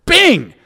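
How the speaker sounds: loud, high punch noise; mock punch bing. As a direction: ring out the hit like a bell. Second punch retold as sound.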